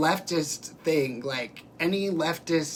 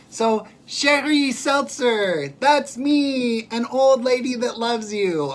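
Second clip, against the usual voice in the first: much higher, louder and slow, savouring each word; gushing comment read; 8 semitones higher, 7 dB louder, slower than usual. Acted. Reading a viewer's comment aloud to the chat, high and gushing. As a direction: read the fan's note like a delighted announcement.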